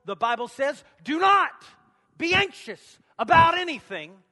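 shouted scripture, high and loud; loud preacher quoting scripture; preaching at full force. Acted. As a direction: thunder it out to the congregation.

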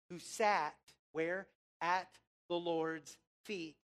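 ordinary talk

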